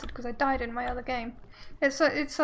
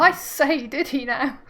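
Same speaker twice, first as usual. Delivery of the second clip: put-on posh London accent; 7 dB louder, slower than usual. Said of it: "She's from London".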